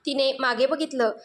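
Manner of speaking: ordinary delivery, nothing marked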